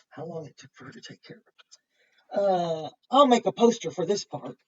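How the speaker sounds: usual speaking voice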